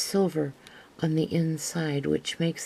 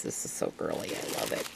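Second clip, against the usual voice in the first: delighted whisper; 8 dB quieter, faster than usual. Real, not acted. Gushing over a gift to viewers, softly whispered.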